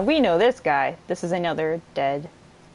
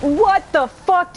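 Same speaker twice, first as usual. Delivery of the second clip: slow, drawn-out, louder and higher disbelief; stunned shout; 10 semitones higher, 5 dB louder, much slower than usual. Real, not acted. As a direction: stretch it out in shock. Shocked by new ability.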